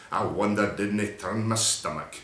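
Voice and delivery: ordinary delivery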